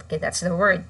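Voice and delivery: ordinary delivery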